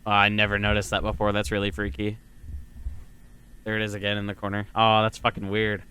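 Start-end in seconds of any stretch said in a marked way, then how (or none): none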